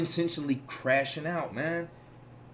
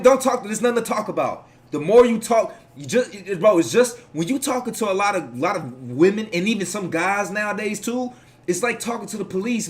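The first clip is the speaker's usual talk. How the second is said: ordinary delivery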